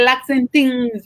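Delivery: Lots and Things said very slowly, copying the owner's way; a mild copy of her accent, slow on Things; imitating her accent. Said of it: "pronounce it with the things".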